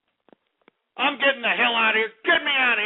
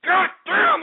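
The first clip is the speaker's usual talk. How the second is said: enraged yelling; 7 semitones higher than usual. Real, not acted. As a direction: scream at the callers in real rage. Host rants at callers, enraged.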